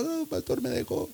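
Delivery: high, soft mock wail; lloriqueo imitado. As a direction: imitate a tearful plea to the pastor. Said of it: "llega llorando". (imitando llanto, con voz aguda y bajita)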